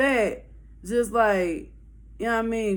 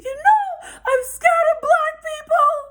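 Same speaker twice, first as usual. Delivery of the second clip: loud high squeal imitating a frantic girl; hammed-up hysterical confession; mock panicked wail; 6 dB louder, slower than usual. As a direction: wail it slowly at the top of the voice. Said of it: "She really freaking the fuck out".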